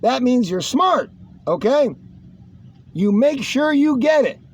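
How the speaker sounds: ordinary talk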